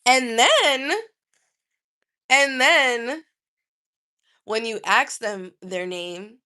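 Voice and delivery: normal talking voice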